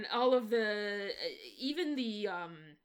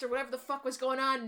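normal talking voice

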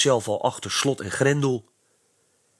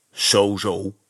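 a hint of a grumbling policeman; lightly voiced gruff grumble; mild policeman's grumble; 3 dB louder than usual. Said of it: "bromde de knorhaan".

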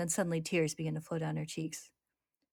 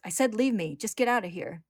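higher, loud angry yell; 5 semitones higher, 7 dB louder than usual. Acted. Risa yelling at Al to leave, loud. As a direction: shout him away. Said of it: "she yells".

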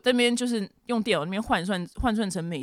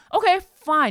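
exasperated; 11 semitones higher, 7 dB louder than usual. Real, not acted. Exasperated at officer's question.

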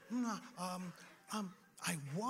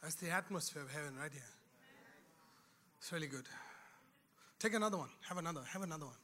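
softer, gentle ministry voice fading toward a hush; guided breathing prayer; 4 semitones lower, 6 dB quieter, faster than usual. Acted. Pastor guiding congregation to breathe in heaven, softly fading.

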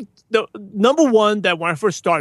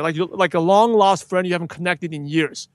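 usual speaking voice

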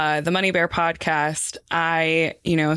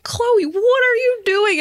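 high, loud exclamation of dismay; exasperated cry at yourself, half laughing; mock self-scolding; 5 dB louder than usual. Acted self-scolding at her loud voice.

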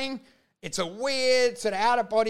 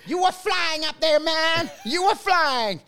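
high-pitched, hyped-up Jersey guy impression; over-the-top Jersey boy; 7 semitones higher, slower than usual. Booker praising a comic after his set, over-the-top Jersey boy. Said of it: "Jersey boy".

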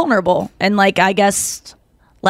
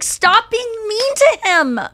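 mock screaming at TV; 11 semitones higher than usual. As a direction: re-enact yelling at the TV, voice pitched way up. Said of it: "I'm screaming at the T V".

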